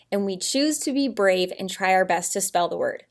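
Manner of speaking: normal talking voice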